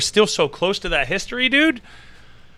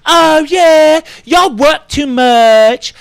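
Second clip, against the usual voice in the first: high, slow hammed-up British accent; mock British accent; 8 semitones higher, 7 dB louder, slower than usual. S0 mocking a British girl to S1 in a high mock British accent. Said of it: "especially that girl".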